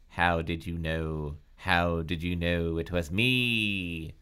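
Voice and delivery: low, slow, drawn-out question; slow, low interrogation; glaring accusation. A narrator's reading. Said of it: "She glared at Brenda".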